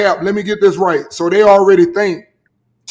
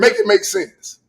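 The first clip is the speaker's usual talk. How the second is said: much higher, incredulous plea; exasperated disbelief; 11 semitones higher, slower than usual. Real, not acted. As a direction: throw up your hands in disbelief. Exasperated at friend's boxing take.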